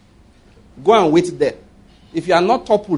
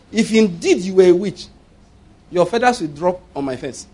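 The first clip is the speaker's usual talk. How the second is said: ordinary talk, nothing marked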